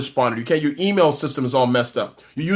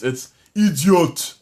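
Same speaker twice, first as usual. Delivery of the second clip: put-on Nigerian accent; much slower than usual. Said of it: "I love doing African accents".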